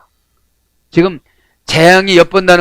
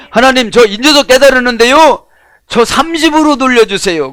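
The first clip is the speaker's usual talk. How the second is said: higher, louder mock plea to God; pleading prayer voice; 7 semitones higher, 3 dB louder than usual. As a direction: act out a believer begging God for youth. (하나님께 애원하는 높은 목소리)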